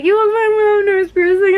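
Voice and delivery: breezy reassurance; mild mock reply; quoting boyfriend. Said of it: "he's like".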